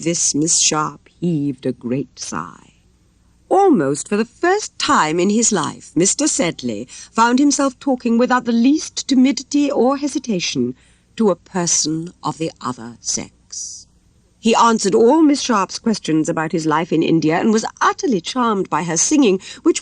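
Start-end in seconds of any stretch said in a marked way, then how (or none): none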